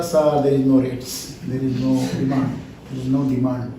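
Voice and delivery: low, subdued mock grumble; imitated farmer complaint. Farmer complaining to sir about no demand, low mock complaint. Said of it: "Every farmer is complaining about".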